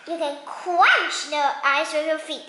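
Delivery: usual speaking voice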